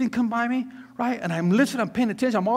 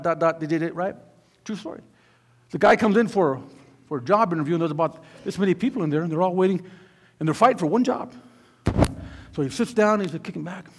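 usual speaking voice

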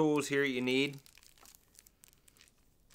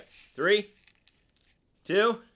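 higher, louder dramatic countdown; excited countdown; 9 semitones higher, 6 dB louder than usual. Real, not acted. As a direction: count it down with suspense. Countdown to the reveal.

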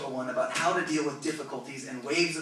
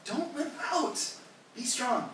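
God voiced high; 8 semitones higher, 3 dB quieter, slower than usual. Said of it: "God's like".

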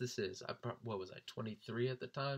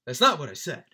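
loud, higher mock retort; quoted movie one-liner; 4 semitones higher, 14 dB louder than usual. Imitating friend's movie one-liner, loud mock retort. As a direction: punch it out as a borrowed movie line. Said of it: "he's stolen one from Django Unchained".

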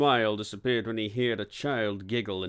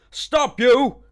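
high, loud yell at a runaway; 13 semitones higher, 10 dB louder than usual. A narrator's reading. Inspector climbing barrels after a child, yelling. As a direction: bellow an order upward in pursuit. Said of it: "Alborough yelled again".

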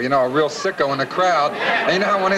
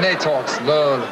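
slow, stretched-out words; drawn-out slow talk; slower than usual. Shown as a demonstration. Host teasing Southern girls' speech, drawn out slowly. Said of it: "they talk real sl-, so slow".

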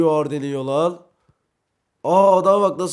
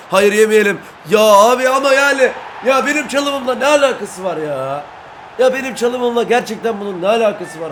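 loud, high protest; indignant shout; 6 semitones higher, 6 dB louder than usual. Real, not acted. Gamer shouting at teammates who blame his dribble, indignant. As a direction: yell back defending yourself.

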